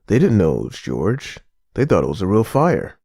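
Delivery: usual speaking voice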